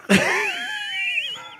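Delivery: a high squeal of laughter; high shrieking laugh. Real, not acted. Friends laughing at the uncle story, high shriek.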